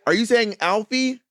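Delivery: slow, high question ending up on "Alfie"; exaggerated rising "Alfie?"; insistent checking. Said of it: "just keep saying the thing".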